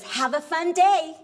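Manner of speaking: high, loud acted farewell; cheery send-off. Husband forgetfully calls to departing wife, cheery. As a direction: call it out brightly, without a thought.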